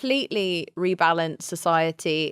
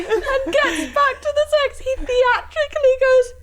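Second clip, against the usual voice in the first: high pitched laughing. Real, not acted. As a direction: squeak it out through laughter. Laughing through the story.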